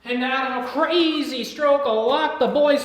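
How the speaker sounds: high, loud trailer narrator; mock announcer. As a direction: hype it like a movie trailer.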